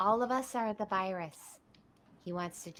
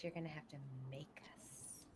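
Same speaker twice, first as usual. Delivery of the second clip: whispered threat; 7 semitones lower, 13 dB quieter than usual. Acted.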